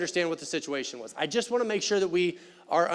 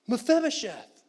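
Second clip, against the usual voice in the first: higher, bright exclaimed name; exclamation; 5 semitones higher than usual. Retold or imitated. David greeting Mephibosheth, exclaimed. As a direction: call the name out with an exclamation point. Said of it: "with an exclamation point".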